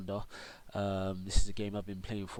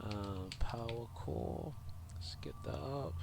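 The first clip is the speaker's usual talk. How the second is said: whispered to himself, softer; whispered aside; 7 dB quieter, slower than usual. Real, not acted. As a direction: murmur it under your breath while busy. Muttering to himself while clicking.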